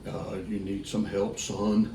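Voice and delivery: put-on low, slow condescending old-timer; old man again. Old bleacher man calls the host son, low and slow. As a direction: talk down to the kid like a skeptical old man. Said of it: "same guy".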